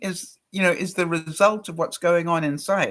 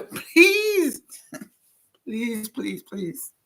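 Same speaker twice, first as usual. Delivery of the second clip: exasperated pleading; 4 semitones higher, much slower than usual. Retold or imitated. I just about lost it.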